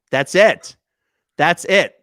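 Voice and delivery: loud, delighted exclaim; excited. Real, not acted. Delighted exclaim naming their drinks. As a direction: burst out with the idea. Thrilled by the Cuterini name.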